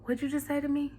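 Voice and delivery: quiet, menacing challenge; mock confrontational. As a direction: ask it softly, as a warning. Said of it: "it's just like that times a thousand".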